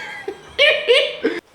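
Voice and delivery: very high, loud cackling laugh; high cackle. Real, not acted. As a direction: lose it laughing. Big laugh after joke.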